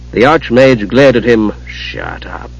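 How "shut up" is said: very soft, low rebuke; quiet menace. A narrator's reading. Raistlin glaring at the chattering kender, quietly menacing. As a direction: silence them almost under your breath.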